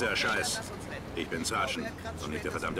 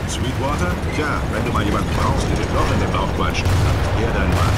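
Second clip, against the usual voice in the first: louder, raised over gunfire; calling over noise; 12 dB louder than usual. Acted. Sergeant briefing new recruit over gunfire, calling out. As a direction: make yourself heard over the din.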